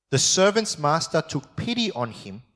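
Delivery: preacher reading scripture aloud. Acted. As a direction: read the Bible passage out to the congregation. Reads the parable aloud.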